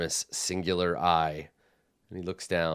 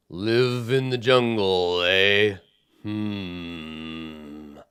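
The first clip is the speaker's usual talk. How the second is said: skeptical giant; much slower than usual. Acted. Giant voice continues.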